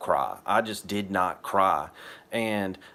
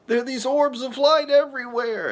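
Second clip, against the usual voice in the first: high, louder, marveling; high, wondering voice; awestruck; 5 dB louder than usual. Retold or imitated. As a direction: tell her about the light, pitched way up. Awed retold reply to wife.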